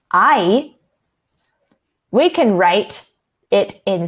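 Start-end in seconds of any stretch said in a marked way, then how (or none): 0.1-0.7 "I" said like "eye"; long I sound. Shown as a demonstration. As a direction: model "I" as the i in fire. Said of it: "long I, that sounds like".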